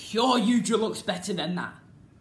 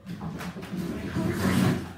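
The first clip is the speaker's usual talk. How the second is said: low, breathy hushed take; dramatic whisper; slower than usual. Pretend. Fan reviewing suits, whispering the opener. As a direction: hush it like a secret. Restarts the line in different voices.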